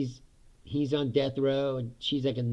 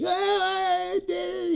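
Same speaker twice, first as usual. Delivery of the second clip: high, slow mock confession; mock breakdown; much slower than usual. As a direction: drag out a hammed-up confession, high. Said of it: "He's like".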